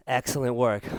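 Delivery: calm praise. Real, not acted. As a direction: praise them gently as they rest. Quiet cool-down praise.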